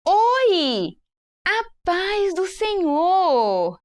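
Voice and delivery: high, very slow greeting; animated welcome. Shown as a demonstration. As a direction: stretch out a joyful welcome. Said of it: "Então, olha". (saudação alegre e lenta)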